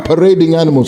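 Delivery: ordinary delivery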